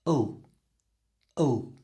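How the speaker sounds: "Oh" with tongue rising to the teeth; the dark L sound. Shown as a demonstration. Teacher demonstrating the L tongue movement. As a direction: hold "oh" then lift tongue for L. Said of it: "Watch me".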